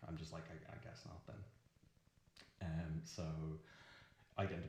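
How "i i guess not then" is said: much softer, near whisper; a barely voiced sheepish reply; deflated mumble. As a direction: trail off, rejected.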